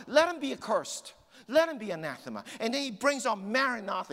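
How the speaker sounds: high, loud, relentless sermon; impassioned preacher at full force; fired-up preaching. Acted. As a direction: hammer it home with mounting passion. Preaches Paul's passion at full force.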